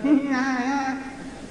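high, drawn-out bleating noise; mock goat bleat. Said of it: "You can bleat and talk".

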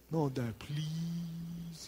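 put-on very slow pleading kid voice; mock-pitiful son begging dad. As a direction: stretch out the plea, whining.

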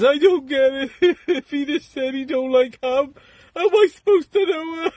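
high falsetto widow, laughing through it; English wife. Retold or imitated. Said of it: "English woman says".

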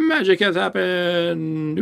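hammed-up victory declaration; mock celebration. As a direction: proclaim it like a grand fanfare. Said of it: "we gotta celebrate".

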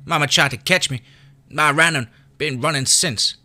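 put-on a pained child recounting loss; high child's voice with pain; hurting kid. A narrator's reading. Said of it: "the flash of pain across his face".

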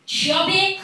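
loud jeering shout across the yard; mocking yell. Boy taunting the narrator about her weight, loud jeering shout. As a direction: bellow it like a taunting schoolboy. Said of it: "and he was like".

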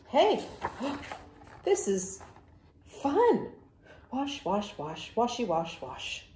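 loud, high, happy splashing voice; delighted pigeon. Acted. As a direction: sing and play in the tub like a child. Pigeon enjoying the bath.

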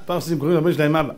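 usual speaking voice

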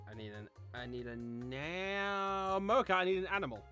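ordinary talk, nothing marked

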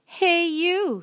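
high, loud storybook call-out; grouchy challenge. A narrator's reading. Grouchy ladybug accosting a stranger, high loud challenge.

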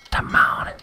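breathy whispered plea; whispering. Real, not acted. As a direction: whisper it under your breath. Waiting for heals in game.

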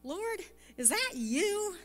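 mock-tentative question, high and drawn out; lightly acted wondering, voice lifted high. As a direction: imitate a puzzled young man, slow and high-pitched. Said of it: "he's like".

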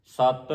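ਸਤ loud with a hard doubled t; heavy, doubled consonant. Shown as a demonstration. Teacher drilling the congregation, heavy geminated ਸਤ. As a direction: land heavily on the t of ਸਤ. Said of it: "ਇਹ ਭਾਰਾ ਬੋਲਣਾ ਹੈ".

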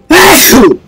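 a full, loud sneeze that bursts out; loud real sneeze. Real, not acted. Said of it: "I'm sneezing!".

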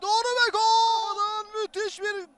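long loud goal scream; screaming football commentator. Real, not acted. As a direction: yell the goal slowly. Goal call.

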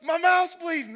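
shrieking high with joy; overjoyed cry. Retold or imitated. George overjoyed to find his mouth bleeding, high joyful shriek. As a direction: cry out in delighted shock. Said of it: "He's so happy".

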